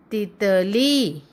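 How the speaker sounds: तितली slowly, syllable by syllable, ending on a long ली; drawn-out teaching demo of तितली. Shown as a demonstration. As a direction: stretch the final ee of तितली. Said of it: "शब्द का उच्चारण थोड़ा लंबा होता है".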